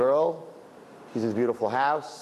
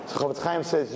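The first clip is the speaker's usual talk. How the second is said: usual speaking voice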